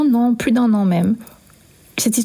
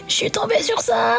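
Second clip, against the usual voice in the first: much higher, bursting with disbelief; high excited squeal; 11 semitones higher than usual. Retold or imitated. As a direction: play the sister squealing out of the exam room. Sister announces her exam text.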